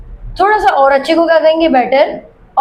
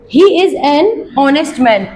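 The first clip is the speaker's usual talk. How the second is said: usual speaking voice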